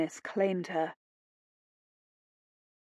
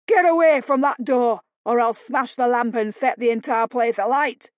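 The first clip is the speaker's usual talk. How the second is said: shouted threat; 3 semitones higher, 4 dB louder than usual. Acted. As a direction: threaten them at full voice. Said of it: "Tom shouted".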